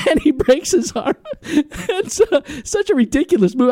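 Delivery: high voice breaking up with laughs; real helpless laughter. Real, not acted. Host recalling a ridiculous movie scene, laughing hard. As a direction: struggle to talk through the giggles.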